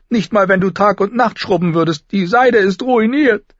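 high, loud scolding, slowing at the end; indignant girl. Acted. Sansa blames her sister for the silk, indignant. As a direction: scold and drag out the final complaint.

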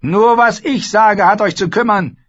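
high, loud, imperious queen voice; Cersei, haughty queen. Acted. Queen lecturing Sansa at the feast, haughty and loud. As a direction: play the proud queen lecturing the girl.

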